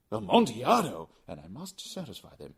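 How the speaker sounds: he can't let it go; Fortunato, fixated. Acted. Fortunato repeats the word.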